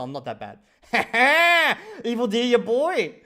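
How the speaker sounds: loud, high, slow bragging; cocky boast. Real, not acted. Boasting to chat after learning he was right, loud and cocky. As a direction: show off after being vindicated.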